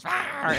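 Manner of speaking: alien roar. Retold or imitated.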